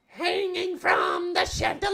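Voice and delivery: very high cartoon squeak; Toad. Trying out the voice from Mario, high and squeaky. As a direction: squeal it out in a high mushroom-creature voice. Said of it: "what's Toad's voice?".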